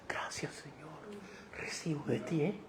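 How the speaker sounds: barely audible grateful prayer; devout murmur. Retold or imitated. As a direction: murmur it softly like a believer receiving. Oyente devoto recibiendo en el culto. (murmullo devoto casi inaudible)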